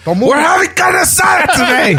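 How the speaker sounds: high-pitched mock shout; over-the-top happy yelling; all-caps dad. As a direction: holler a dinner invite like an all-caps text. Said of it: "it's always in all caps".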